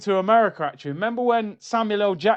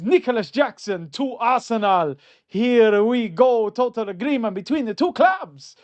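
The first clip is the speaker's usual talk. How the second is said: Fabrizio Romano impression; 3 semitones higher, 5 dB louder than usual. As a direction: announce the transfer in a hammed Italian accent. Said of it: "I think my Fabrizio is okay. The Italian accent".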